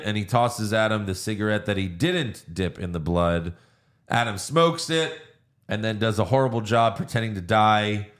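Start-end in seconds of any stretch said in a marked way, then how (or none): none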